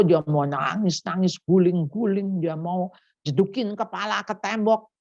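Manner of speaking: normal talking voice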